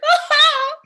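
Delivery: high, loud hammed-up sob; hysterical mock wail. Little girl watching Lassie, mock hysterical wail. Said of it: "without sobbing hysterically".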